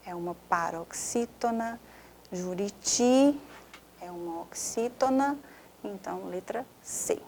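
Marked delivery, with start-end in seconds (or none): 2.3-3.4 juriti slow, held on final TI; drawn-out stressed syllable. Shown as a demonstration. Teacher checking the answer, juriti held long on TI. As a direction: stretch the last TI of juriti.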